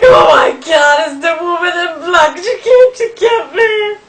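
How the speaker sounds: high, loud terrified shriek, slowed; terrified scream. Retold or imitated. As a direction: shriek as if the ghost has come for you. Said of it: "And I was like".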